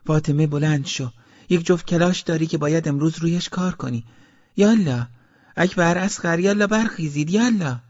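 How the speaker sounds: high, insistent mother's call; mother rousing the children. A narrator's reading. Mother waking Fatemeh, Akbar and Asghar, high-pitched urging. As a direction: call them out of bed, pitched high and urgent. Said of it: "صدای مادرم را شنیدم".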